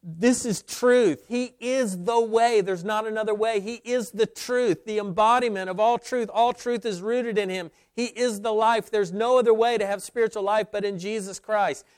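a hint of a fervent believer declaring; believer's confession. Believer voicing an embrace of Jesus' claims, lightly voiced fervor. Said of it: "for him and say".

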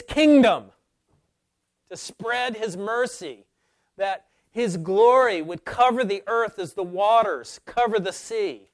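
high, thundering pulpit cry; soaring full-force preacher's voice; impassioned preaching. Real, not acted. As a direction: plead it out loud with long, weighty stresses.

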